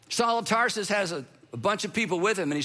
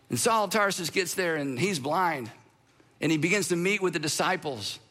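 ordinary talk

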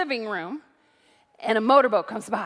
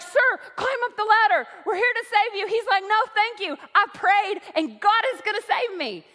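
raised retelling; 7 semitones higher than usual. Retold or imitated. Helicopter crew and stubborn man at the peak, higher. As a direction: pitch it up for the climax.

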